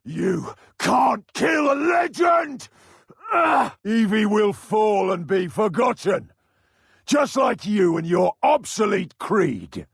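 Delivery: high raging yell; cornered killer roaring defiance; furious shout. Acted. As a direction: scream it in defiant fury. Villain rages in combat.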